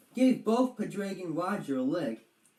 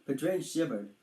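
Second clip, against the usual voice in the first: usual speaking voice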